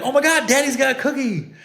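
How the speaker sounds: high-pitched mock amazement; excited reaction. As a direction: imitate an excited parent cheering a child on. Parents react to cookie reveal.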